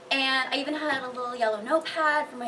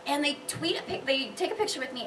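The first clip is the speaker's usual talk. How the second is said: ordinary talk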